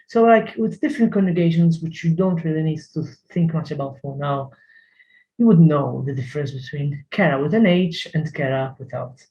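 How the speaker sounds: ordinary delivery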